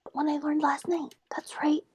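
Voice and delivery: soft, secretive whisper; hushed, mostly voiceless murmur of a character. Acted. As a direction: whisper it as if to yourself. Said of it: "And she whispers into it".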